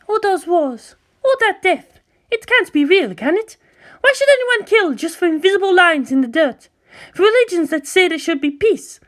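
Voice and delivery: put-on high, wondering and troubled girl's voice; young girl asking Shinji whether the world is that cruel, high and earnest; young girl character voice. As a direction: voice a naive girl asking about the outside world.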